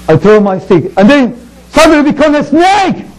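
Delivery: usual speaking voice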